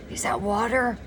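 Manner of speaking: a touch of feeble rasp, rising to ask; weak, raspy question. A narrator's reading. Parched Zero asks Stanley for water, weak and raspy. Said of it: "His voice was weak and raspy".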